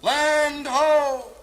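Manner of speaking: mock sailor hollering; lookout's yell. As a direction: call it down from high up. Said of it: "the guy in the mast yells".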